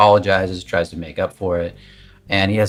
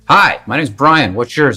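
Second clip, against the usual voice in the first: higher, louder, pushed-out greeting; pushy fake confidence; 6 semitones higher, 9 dB louder than usual. Guy at the bar greeting a woman with pumped-up, pushy confidence. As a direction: puff up and push the words at her. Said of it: "this pushing energy that's really hard".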